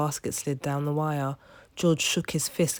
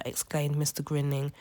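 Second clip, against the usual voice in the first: ordinary delivery, nothing marked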